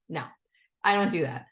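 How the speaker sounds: ordinary talk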